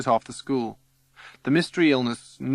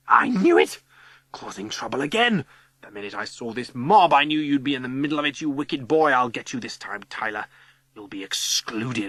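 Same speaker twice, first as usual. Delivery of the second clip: scolding schoolteacher. Acted. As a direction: scold a troublemaker with self-righteous authority. Missus Summers scolding at school.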